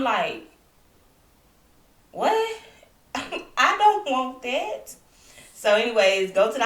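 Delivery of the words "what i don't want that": high-pitched, drawn-out incredulous protest; dismayed disbelief. Retold or imitated. Patient told it's pneumonia or sepsis, high dismayed protest. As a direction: squeal it in disbelief, stretching the words.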